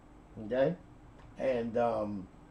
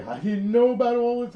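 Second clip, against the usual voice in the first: surprised; 10 semitones higher, 6 dB louder than usual. Retold or imitated. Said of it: "she could only say".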